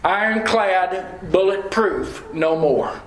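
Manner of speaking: slow, weighty, each word set apart; stately and deliberate; slow solemn refrain. Acted. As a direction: lay it down slowly like a verdict. Repeated refrain, first take.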